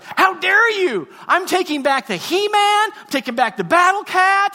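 high, loud mock outrage; furious sister. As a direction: yell like an enraged sister, hammed up. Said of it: "She got mad".

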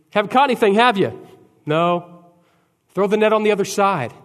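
high and loud, called across the water; Jesus calling to the boat. Acted. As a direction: shout the advice from the shore.

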